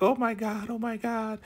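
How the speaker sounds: very high, squealing panic; panicked squeal. Retold or imitated. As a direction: squeak out the alarm. Other Elder Mother panicking.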